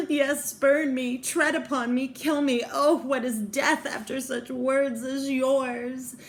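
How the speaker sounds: high, loud melodramatic pleading; hammed-up lovelorn despair; acted anguished plea. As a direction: implore like a tragic heroine, building in intensity. Girl's anguished speech to her lover.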